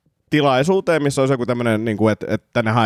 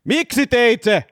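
pitched-up, drawn-out demand easing toward usual voice; kuulustelija, tuskastunut; 7 semitones higher than usual. Acted. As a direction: press the suspect impatiently for a confession. Interrogator in acted scene. (tuskastunut kuulustelija)

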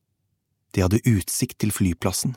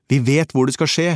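urgent, higher-pitched raised voice; shouting into phone; 6 semitones higher, 4 dB louder than usual. A narrator's reading. Narrator tells Ivana where the attack comes, urgent shout. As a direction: call it urgently down the line. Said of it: "ropte jeg inn i røret".

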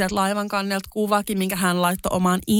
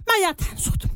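much higher, emphatic mä jätän sut; breaking up with him at his family's home, pitched up; decisive breakup line; 12 semitones higher than usual. Retold or imitated. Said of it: "Sit mä päätin".

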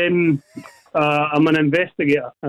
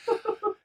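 giddy laugh; 9 dB quieter than usual. Real, not acted. Winner giddy after win.

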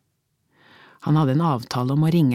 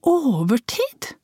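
slow, much higher disbelieving Overtid?; incredulous echo; 10 semitones higher, much slower than usual. Acted. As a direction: throw his word Overtid back, stunned.

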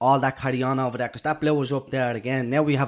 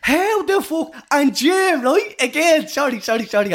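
disbelief; 11 semitones higher, 3 dB louder than usual. Real, not acted. Stunned by the lucky number.

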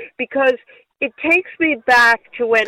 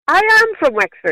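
higher, quicker, insistent protest; indignant insistence; 8 semitones higher, faster than usual. Retold or imitated. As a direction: insist on it, pitch jumping up. Insisting when her origin is doubted.

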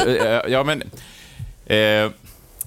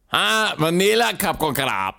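high, slow, drawn-out mock Thai; hammed-up Thai accent, pitched well up; mock Thai man; 9 semitones higher, slower than usual. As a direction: imitate a Thai ice-cream seller, badly. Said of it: "thailändsk man som".

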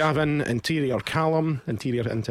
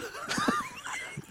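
high breathy giggle; 11 dB quieter than usual. Real, not acted. Laughing at silly pun names.

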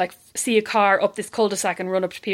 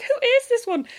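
high puzzled wondering; mock puzzled guests; 13 semitones higher than usual. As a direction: wonder who this odd host is. Imagined guests' reaction.

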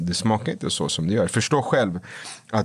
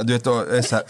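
ordinary talk, nothing marked

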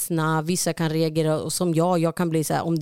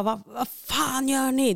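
put-on higher, slow scared snap at the kids; scared angry snap; 6 semitones higher, slower than usual. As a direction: snap at the kids out of fright, slowly. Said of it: "jag blir så rädd". (rädd och arg utbrott, högre och långsamt)

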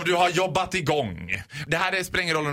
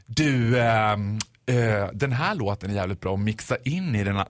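low, hesitant know-it-all; 9 semitones lower than usual. Acted. Amateur musician advising the DJ, low-voiced know-it-all. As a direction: sidle up with unasked expert advice. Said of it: "Mister Besserwisser som kommer fram".

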